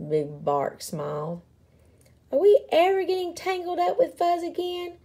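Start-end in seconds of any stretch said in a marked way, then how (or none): 2.3-5.0 high, quick rallying question; crying out. A narrator's reading. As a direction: cry it to rally the crowd. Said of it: "cried Pipsqueak".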